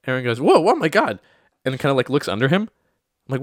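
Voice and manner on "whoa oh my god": louder, higher shocked exclamation; retold startled yelp. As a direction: act out a friend's sudden alarm. Retold friend startled under table.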